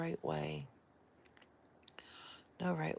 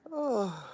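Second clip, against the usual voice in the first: loud sudden yawning "oh"; 5 semitones higher, 7 dB louder than usual. Real, not acted. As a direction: let a real yawn break out. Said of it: "a yawn happened".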